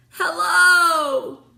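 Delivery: loud, high shout of hello; calling out. A narrator's reading. Virgil shouting up to friends above, loud. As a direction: call up with everything you have. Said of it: "he called".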